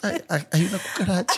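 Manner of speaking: high, prissy little squeal; prim and dainty. Retold or imitated. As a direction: play it all proper and delicate. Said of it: "toda puestecita".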